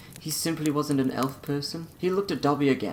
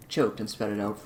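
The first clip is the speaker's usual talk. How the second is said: plain reading voice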